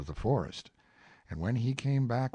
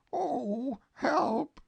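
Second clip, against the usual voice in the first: high, louder bear's cry for help; mock alarmed cry; 5 dB louder than usual. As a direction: cry out, suddenly stuck. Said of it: "Pooh stuck in the hole".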